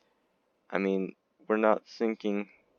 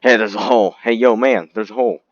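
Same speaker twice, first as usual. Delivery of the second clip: alarmed engineer; 2 semitones higher, 12 dB louder, faster than usual. Acted. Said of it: "An engineer come up and be like".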